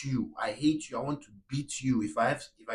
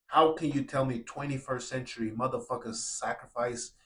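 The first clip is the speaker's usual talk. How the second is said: ordinary talk, nothing marked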